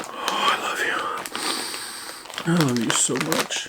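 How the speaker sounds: breathy whisper to the cookies; whispered love. Real, not acted. Snacker whispering love to tasty cookies, soft whisper. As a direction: whisper it like a secret crush.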